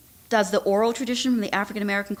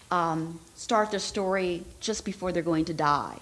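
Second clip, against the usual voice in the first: normal talking voice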